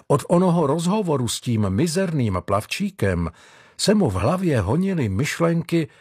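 ordinary delivery

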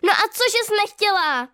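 high, loud angry snap; an upset girl snapping back, high and loud. A narrator's reading. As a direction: lash out at her friend. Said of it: "utrhne se na ní Tereska".

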